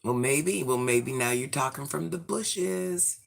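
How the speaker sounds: normal talking voice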